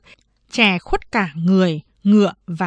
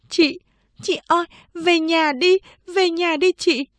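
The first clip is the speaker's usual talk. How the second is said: high-pitched younger sister's plea; high, urging child character; pleading little sister; 8 semitones higher than usual. A narrator's reading. Younger sister urging elder sister home.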